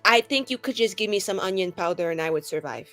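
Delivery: usual speaking voice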